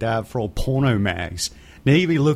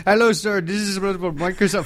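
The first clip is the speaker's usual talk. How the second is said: higher put-on caller voice; imitated scam caller; 7 semitones higher than usual. Second scam caller on call waiting, mock salesman greeting. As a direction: mimic a phone scammer, pitched up.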